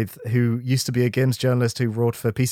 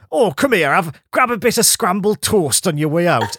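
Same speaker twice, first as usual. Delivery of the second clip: friendly northern English voice, pitched up; a kindly northern local offering food; northern accent; 6 semitones higher than usual. Retold or imitated. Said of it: "everyone responds to you being like".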